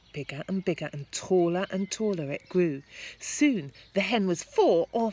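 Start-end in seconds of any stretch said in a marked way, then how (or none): none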